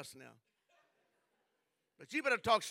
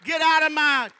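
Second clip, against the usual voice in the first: much higher and louder, shouting each command; preaching at full force; 10 semitones higher, 22 dB louder than usual. Acted. Preacher rallying the congregation against the devil, shouting. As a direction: drive the devil out, ringing from the pulpit.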